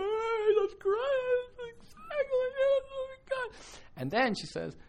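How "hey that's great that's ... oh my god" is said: much higher, crying voice, slowing down; retold tearful breakthrough. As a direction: let the tears break through high. Woman quoted breaking into tears.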